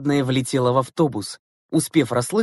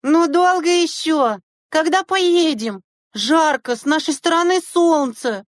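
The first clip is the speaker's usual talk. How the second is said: very high, drawn-out whine; impatient whining; 4 dB louder, slower than usual. A narrator's reading. Girls waiting on the hot bus, whining impatiently. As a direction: whine like fed-up schoolgirls. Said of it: "нетерпеливо заныли девочки".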